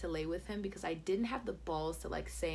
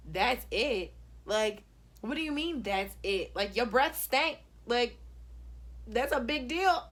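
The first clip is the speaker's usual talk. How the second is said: indignant retort; 4 semitones higher, 4 dB louder, slower than usual. Retold or imitated. Acts indignant reply to him.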